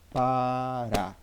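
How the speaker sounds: Pará drawn out, both syllables held; stress demonstration. Shown as a demonstration. Teacher demonstrating the stressed syllable, Pará slowly drawn out. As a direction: stretch out Pará so the final RÁ can be heard. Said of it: "Qual é a sílaba que eu pronuncio mais".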